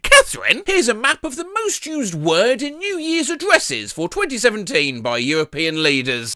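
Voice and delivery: ordinary talk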